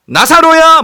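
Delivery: very high, loud call; commanding cry. Acted. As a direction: call out to summon someone from a tomb. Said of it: "뭐라고 외치십니까".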